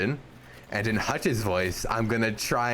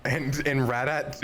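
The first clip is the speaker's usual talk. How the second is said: ordinary delivery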